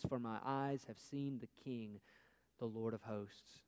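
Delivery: hushed lament. A narrator's reading. Reading Isaiah's lament.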